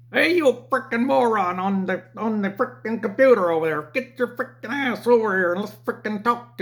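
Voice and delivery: high, loud mock New Jersey jerk; hammed-up rude New Jersey customer; Jersey accent. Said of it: "from Jersey".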